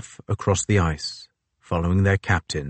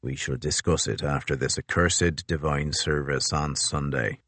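lower, softer captain's voice; a lower, weary captain; Crozier voice; 5 semitones lower, 6 dB quieter than usual. A narrator's reading.

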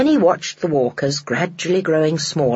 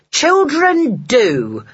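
slow, higher, louder scorn; scornful; 8 semitones higher, 4 dB louder, much slower than usual. A narrator's reading. The father sneering at the interfering lady, slow and loud. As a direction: drag it out with contempt. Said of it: "said the man".

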